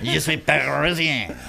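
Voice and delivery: hammed-up French; mock French accent. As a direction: play a cartoon Parisian.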